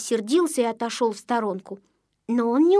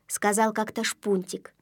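plain reading voice

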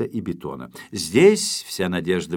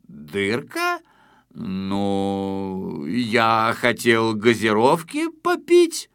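evasive creaky voice; much slower than usual. Acted. Intruder dodging the question.

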